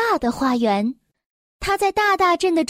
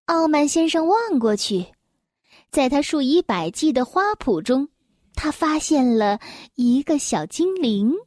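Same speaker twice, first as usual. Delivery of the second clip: plain reading voice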